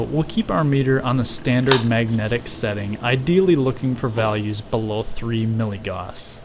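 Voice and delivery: usual speaking voice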